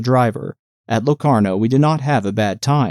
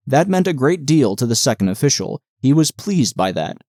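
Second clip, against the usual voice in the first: plain reading voice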